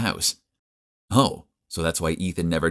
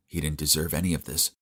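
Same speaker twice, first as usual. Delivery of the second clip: usual speaking voice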